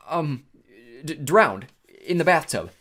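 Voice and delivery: high, loud, stammering; nervous improvising. A narrator's reading. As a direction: stammer out a made-up answer. Grover improvising a lie, nervous.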